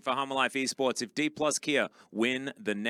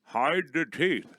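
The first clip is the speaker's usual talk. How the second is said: put-on covered-teeth old man voice; vampire voice; 4 semitones higher, much slower than usual. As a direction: talk through hidden fangs, slow.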